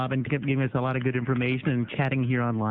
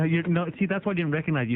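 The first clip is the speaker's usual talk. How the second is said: ordinary talk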